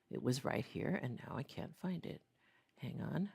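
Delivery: ordinary talk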